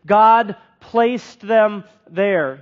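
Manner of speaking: very slow, each word weighted; the preacher drives home the verse to the church, very slow; slow emphasis. Shown as a demonstration. Spell it out slowly, word by word. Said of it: "It says it twice".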